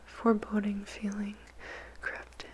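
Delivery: plain reading voice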